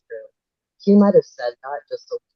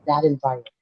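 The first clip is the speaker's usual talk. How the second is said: normal talking voice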